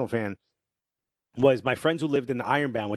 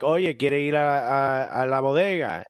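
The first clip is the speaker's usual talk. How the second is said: Dominican Spanish; slower than usual. Retold or imitated. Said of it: "if a Dominican is talking to me".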